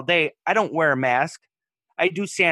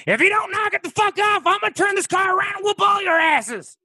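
furious dad shouting from the front seat, much higher and louder; angry dad yelling; 5 dB louder than usual. Retold or imitated. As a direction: scream it like a fed-up father about to turn the car around. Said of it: "he turns around and goes".

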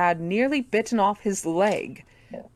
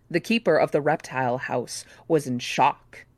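ordinary delivery, nothing marked

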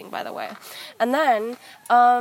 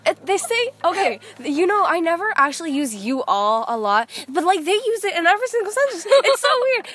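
higher, animated rant; excited; 6 semitones higher, 4 dB louder than usual. Real, not acted. Girl excitedly ranting about how Alabamans talk. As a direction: pile it on with rising excitement.

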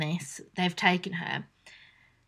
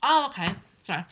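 mouth full; faster than usual. Real, not acted. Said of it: "I'm eating".

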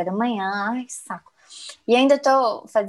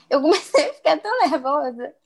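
ordinary talk

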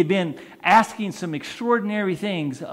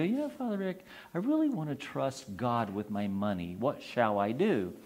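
hushed parishioner; 5 semitones lower, 9 dB quieter than usual. Retold or imitated. Parishioner voiced asking priest.

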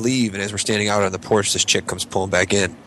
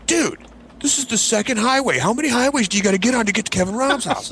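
whiny friend; 13 semitones higher than usual. Retold or imitated. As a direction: play the drunk buddy whining about the long drive. Friend complaining in car.

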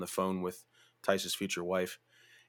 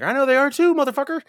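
much higher and louder retort; mock shout back; 9 dB louder than usual. Narrator yelling back at the guy's friend, loud mock retort. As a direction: yell it back in his face.

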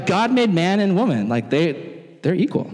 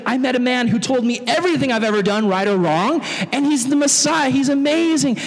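higher, excited telling; higher, thrilled woman; joyful news; 7 semitones higher than usual. Retold or imitated. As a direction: burst with joy.

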